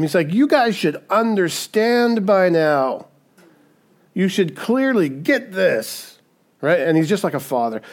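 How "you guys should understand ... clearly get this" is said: exasperated, higher and drawn-out reproach; upset Jesus. Acted. Jesus to disciples who don't get it, upset. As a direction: stretch it out like a fed-up parent. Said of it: "Jesus gets so upset with them".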